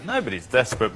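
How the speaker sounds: Nobody's desperate said softly and flat, shrugging it off; quiet, calm version. Retold or imitated. Panellist shows the calm way he could have said it, quiet. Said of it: "you could have gone".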